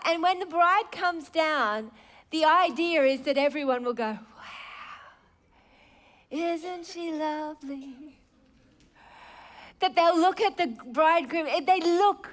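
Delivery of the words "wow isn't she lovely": breathy whispered wow, slow and soft; hushed wonder. Pretend. Preacher voicing wedding guests in hushed awe. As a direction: gasp softly at the bride.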